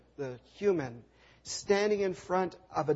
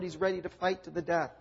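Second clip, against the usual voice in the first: ordinary talk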